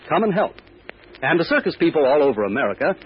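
ordinary talk, nothing marked

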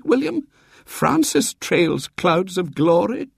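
very high-pitched invalid voice; piping old lady holding court from bed; feeble great-aunt. A narrator's reading. Great-aunt Jane inspecting the young visitors, feebly. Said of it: "said Great-aunt Jane feebly".

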